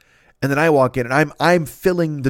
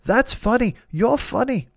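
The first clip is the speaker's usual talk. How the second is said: higher-pitched, amused English impression; John Cleese; 6 semitones higher than usual. Hero points at the comedian, amused high-pitched impression. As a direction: imitate an amused English hero giving praise. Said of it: "He's laughing".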